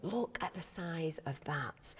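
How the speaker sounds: awed hushed whisper. Real, not acted. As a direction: breathe it out in wonder. Awed at the huge lion.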